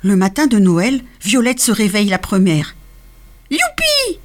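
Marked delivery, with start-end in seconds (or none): 3.5-4.2 youpi couiné très aigu; couinement enchanté. A narrator's reading. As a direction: couiner de joie. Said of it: "couine-t-elle enchantée". (petite souris qui couine de joie)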